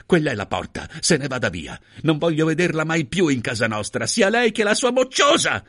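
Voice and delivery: building from firm order to high, loud shriek; frenzied anger. Acted. Hostess banishes mother and child, frenzied anger. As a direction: rage mounting to a frantic yell. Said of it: "gridò la Merky con frenesia".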